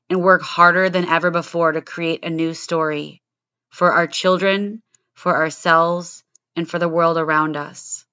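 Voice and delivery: punchy, low-pitched show opener; scripted podcast intro. Acted. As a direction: deliver a prepared opening monologue with firm, deliberate emphasis.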